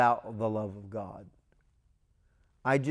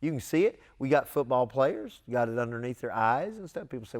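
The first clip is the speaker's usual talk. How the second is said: ordinary delivery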